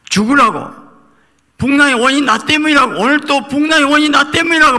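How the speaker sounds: 높고 크게 끌어올린 절규; 목청 높여 자기 탓을 외치듯. Acted. 요나 고백을 고조시켜 외침. (설교 중 요나가 자기 탓이라 외치는 높고 큰 절규)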